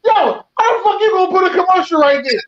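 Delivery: retold angry shout. Host reliving his anger at a podcast ad, shouting. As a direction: shout it up high like you're reliving the rage. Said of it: "I was mad as shit".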